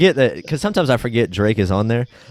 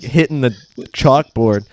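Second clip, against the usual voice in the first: usual speaking voice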